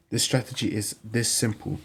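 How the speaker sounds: ordinary delivery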